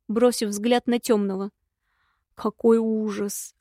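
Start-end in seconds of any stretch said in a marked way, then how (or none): 2.3-3.5 slow, stunned exclamation; taken aback, slowly; shocked. Acted. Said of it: "опешил мужчина".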